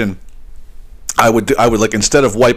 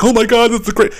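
lightly mocking a gushing online commenter, higher; 12 semitones higher than usual. Mocking Reddit commenters raving about the trick, high and gushing. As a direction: ham up the fake rave. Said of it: "all the people commenting on it".

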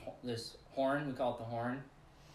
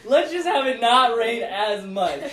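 loud, slow prayer through laughter; laughing prayer; 7 semitones higher, 11 dB louder than usual. Retold or imitated. Asking God for less rain, loud laughing prayer. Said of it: "it started as".

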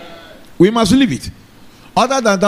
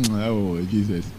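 quiet, lower words dissolving into laughter; laughing; 10 semitones lower, 9 dB quieter, much slower than usual. Real, not acted. Preacher to congregation, chuckling softly as the words fade away. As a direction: laughs through it, trailing off to nothing. Breaks up laughing mid-explanation.

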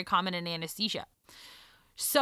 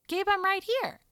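put-on higher, gentle call to a baby; mother calling to her crying newborn; calling tenderly; 10 semitones higher than usual.

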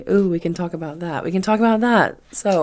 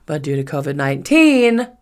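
normal talking voice

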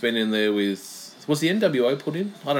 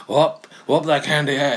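The Rock voice; 2 semitones higher than usual. Retold or imitated. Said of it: "he's saying".

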